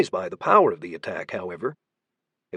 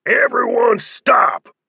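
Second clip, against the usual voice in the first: slow, loud and high order; commanding shout; 12 semitones higher, 6 dB louder, much slower than usual. A narrator's reading. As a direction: bellow to stop the room. Leader halts the shooting.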